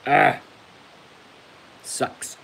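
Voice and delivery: painful cough then a wince; cough, cry out, then a hoarse whisper; cracked rib. Real, not acted. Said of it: "Ow, that hurts to cough".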